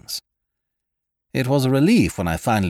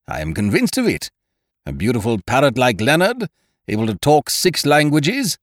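higher, emphatic hearty man; Ukridge, convinced; 6 semitones higher, 4 dB louder than usual. A narrator's reading. Ukridge arguing the parrot was stolen, emphatic. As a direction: insist with growing emphasis.

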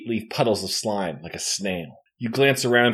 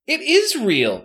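complaining; 14 semitones higher than usual. A narrator's reading. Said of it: "Katie complains".

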